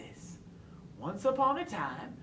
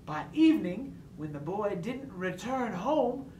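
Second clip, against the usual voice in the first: ordinary delivery, nothing marked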